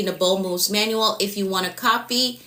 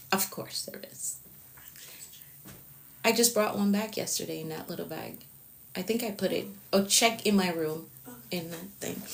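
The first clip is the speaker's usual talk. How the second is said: much softer, half-whispered off-mic aside; quiet aside; 10 dB quieter than usual. Real, not acted. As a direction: turn away and murmur to someone in the room.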